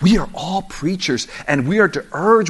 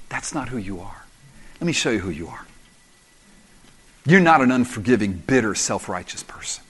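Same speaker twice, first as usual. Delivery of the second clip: calm, gentle rebuke; 6 semitones lower, 5 dB quieter than usual. Shown as a demonstration. Preacher showing how to answer an angry brother gently. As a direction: talk them down softly instead of getting mad. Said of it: "Rather than getting all crazy and mad, just say".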